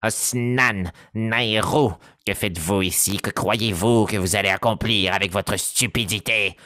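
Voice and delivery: lightly nasal, pressing insult; a hint of a nasal, hurried scornful character; Alphonse voice. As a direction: hurry the insult out through the nose. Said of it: "nasillarde et pressante de mon personnage".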